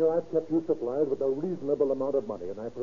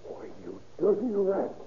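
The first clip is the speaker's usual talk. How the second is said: higher, slow, contemptuous snarl; angry insult; 6 semitones higher, slower than usual. Acted. Lodger insults the sponging husband, slow and angry. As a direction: spit out the insult slowly.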